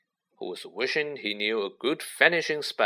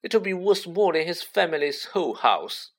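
higher-pitched, smug jibe; smirking; 6 semitones higher than usual. A narrator's reading. Malfoy mocking Weasley's family, smirking. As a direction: taunt with a smirk in the voice. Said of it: "smirked Malfoy".

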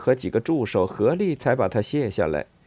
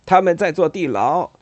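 loud alarmed shout, high; 7 semitones higher, 6 dB louder than usual. Acted. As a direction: yell it out in alarm. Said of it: "他喊道".

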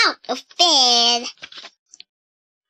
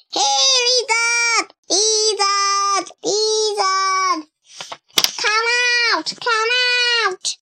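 calling out repeatedly; 8 semitones higher than usual. A narrator's reading. Boy calling again and again for the lizard. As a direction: keep calling for a pet that won't come.

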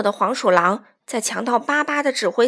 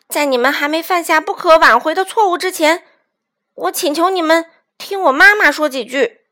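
loud, raised, earnest plea; brave appeal; 5 semitones higher, 7 dB louder than usual. A narrator's reading. Chick pleading with raiders to negotiate, brave appeal. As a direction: summon courage and address the enemy firmly. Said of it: "鼓起勇气".

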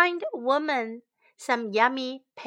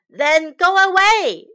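loud, higher shout; 6 semitones higher, 11 dB louder than usual. Acted. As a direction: yell at the beggar to leave. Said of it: "shouted Shen".

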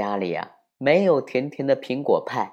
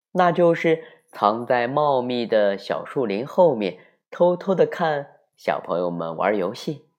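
usual speaking voice

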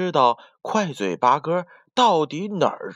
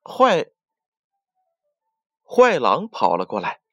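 usual speaking voice

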